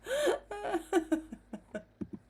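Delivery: high rolling laugh; long laugh. Real, not acted. Laughing at own joke.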